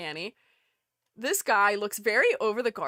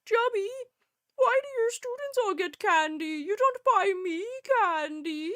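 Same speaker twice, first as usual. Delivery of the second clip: whiny complaint; 10 semitones higher than usual. Retold or imitated. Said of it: "I was like".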